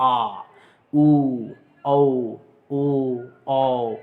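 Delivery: loud sustained back vowels आ उ औ ओ, mouth round and open; back vowel demo. Shown as a demonstration. Teacher sounds back vowels for students to copy. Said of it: "जैसे एकदम पिछला भाग".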